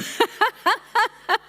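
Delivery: loud, high burst of laughter; big amused cackle; hearty laugh. Real, not acted. Laughing at an audience answer.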